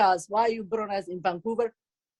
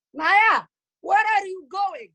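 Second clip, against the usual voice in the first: high, loud motherly call; 9 semitones higher, 6 dB louder, slower than usual. Acted. Mother calling after her daughter as she goes, calling out loudly. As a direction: shout after a child who is leaving.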